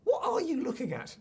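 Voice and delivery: hammed-up action hero challenge; action hero catchphrase. Shown as a demonstration. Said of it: "One more time".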